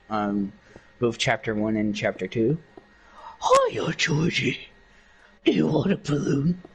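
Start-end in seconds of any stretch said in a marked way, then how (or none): 3.7-6.6 creepy clown voice with a giggle; Pennywise. Shown as a demonstration. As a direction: lure a child as a sinister clown, slowing at the end.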